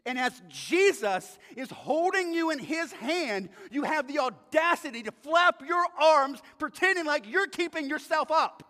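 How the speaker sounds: loud, rising preacher's rebuke; indignant preaching. Acted. Preacher rebukes congregation for flapping their arms, indignant.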